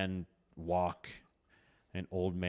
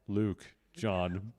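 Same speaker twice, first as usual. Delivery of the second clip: normal talking voice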